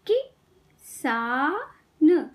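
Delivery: किसान very slowly, with gaps between sounds; syllable by syllable. Shown as a demonstration. Teacher teaching kids to read, syllables apart. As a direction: break किसान into कि - सा - न. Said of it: "शब्द पढ़ना सिखाऊंगी".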